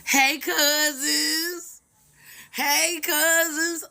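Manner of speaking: high, very slow "hey, cousins"; drawn-out greeting. Acted. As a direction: stretch the greeting out long and high. Greeting newly discovered Scottish cousins.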